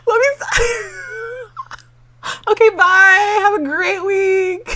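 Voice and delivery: high, loud laughing goodbye. Real, not acted. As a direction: try to sign off while cracking up.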